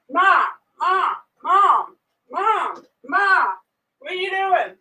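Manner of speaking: high, eager calls of Ma, Ma, Mom; excited daughter calling. Retold or imitated. Teen daughter calling her mom at six am, high and eager. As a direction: imitate a kid calling her mom awake.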